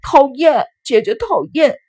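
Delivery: put-on high, loud tearful child's cry; high-pitched crying tantrum; wailing shout. A narrator's reading. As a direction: yell it as a bawling little boy. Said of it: "聪智哇的一声大哭起来， 大声地叫喊着".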